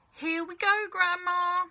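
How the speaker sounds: put-on boy calling out to his grandma with the spoon; cried out. A narrator's reading. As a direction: sing it out to coax her. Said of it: "George cried out".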